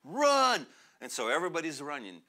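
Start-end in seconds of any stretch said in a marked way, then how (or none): 0.0-0.7 sudden high, loud cry to flee; urgent shout. Retold or imitated. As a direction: yell it out as the danger hits. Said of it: "And he's like".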